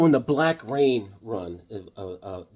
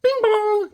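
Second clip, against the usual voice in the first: very high sung bing-bong; loud, high ding-dong mimicry; doorbell imitation; 5 dB louder than usual. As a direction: chime like a doorbell in falsetto.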